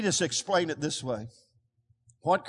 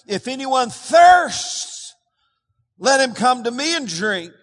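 loud, high, slow call; crying out; 7 semitones higher, 8 dB louder, slower than usual. Retold or imitated. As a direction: proclaim it like a cry to the crowd. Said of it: "It says he cried out".

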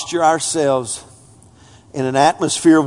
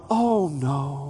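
faint polite refusal; 4 semitones lower, 8 dB quieter than usual. Retold or imitated.

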